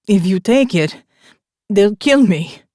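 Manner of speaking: loud, desperate pleading. A narrator's reading. Wounded biker begging Morgan, loud desperate plea. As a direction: beg for your life. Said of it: "He was pleading now".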